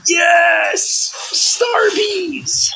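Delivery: high squealing yes; sudden high-pitched cheer; excited squeal. Real, not acted. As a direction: squeal with delight. Excited about getting Starbucks.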